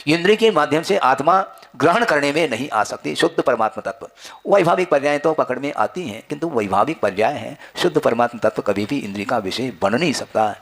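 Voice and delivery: normal talking voice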